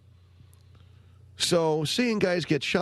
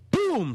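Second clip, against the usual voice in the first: high, punchy mock boom; imitated gunshot boom. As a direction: fire it off like a sudden shot.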